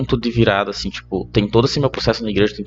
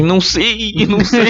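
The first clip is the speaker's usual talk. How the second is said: high, giggly not-knowing; a giggling shrug of a reply; laughing it off; 9 semitones higher, 4 dB louder, slower than usual. Retold or imitated. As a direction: laugh through the words, helpless. Retold reply to Késia, laughing.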